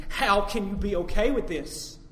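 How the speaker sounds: loud, slow disbelief; incredulous question. Retold or imitated. Preacher asking a wronged person about forgiveness, loud and incredulous. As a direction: ask it in drawn-out astonishment.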